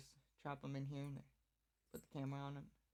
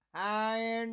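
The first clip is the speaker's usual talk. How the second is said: much louder, cheery hello; loud greeting; 5 semitones higher, 17 dB louder, slower than usual. Retold or imitated. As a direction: call out a greeting. Greeting cows, retold.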